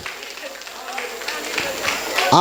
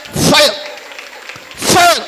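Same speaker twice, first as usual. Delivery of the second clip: fire call; 8 semitones higher, 3 dB quieter than usual. Real, not acted.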